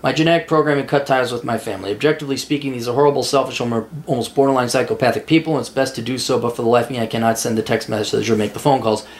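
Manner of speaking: low, monotone read-out; reading a message aloud. Acted. Host reading a viewer's message about family, low monotone reading. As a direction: read it out evenly, pitched well under your talking voice.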